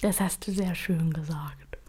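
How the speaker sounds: soft, breathy whispered praise; whispering. Real, not acted. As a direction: whisper it as if moved. Touched reply after emotional answer.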